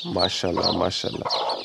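breathy whisper of admiration; soft whispered mashallah over the chicks; whispered praise. Real, not acted. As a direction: breathe it out softly in awe.

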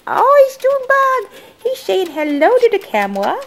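delighted pet greeting. Real, not acted. Pet appears on camera.

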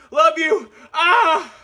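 ordinary talk

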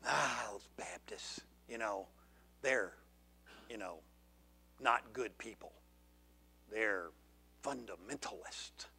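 a quiet sneering imitation of detractors; mocking critics. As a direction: whisper their disdain like gossip. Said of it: "So many people say".

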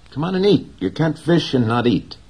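put-on boy's voice. A narrator's reading. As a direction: coax the old man to eat, boyish and high. Boy's voice in read story.

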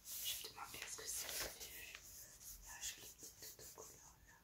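hushed whisper while unwrapping; library whisper. Real, not acted. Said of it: "je ne sais pas pourquoi je parle tout bas".

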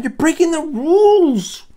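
high, loud, drawn-out accusation; scolding. Acted. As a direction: tattle indignantly on a rule-breaker. Acted scolding of the lion.

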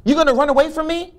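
high, loud, accusing driver; shouted accusation. Retold or imitated. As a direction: shout it at the man walking away.

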